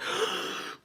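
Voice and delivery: quick shocked intake of breath; sharp gasp. Retold or imitated. Startled by a sudden kiss, a sharp gasp. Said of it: "I was shook".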